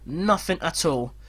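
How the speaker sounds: usual speaking voice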